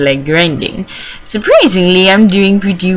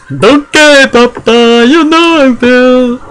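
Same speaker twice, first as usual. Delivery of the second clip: higher, drawn-out put-on voice; hammed-up child to papa; 6 semitones higher, 4 dB louder, slower than usual. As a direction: play an over-eager kid answering papa. Acting the child answering dad.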